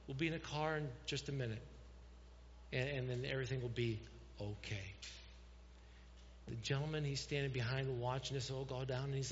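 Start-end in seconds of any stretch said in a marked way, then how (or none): 0.1-4.9 acted patient father, pausing and dropping to a hush; calm dad. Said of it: "I can't believe how calm".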